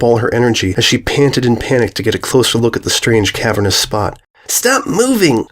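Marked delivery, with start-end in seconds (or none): 4.5-5.5 high urgent call; yelling out. A narrator's reading. Sweetie shouts at the squirming Diamond, urgent. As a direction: order her to freeze. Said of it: "Sweetie yelled out".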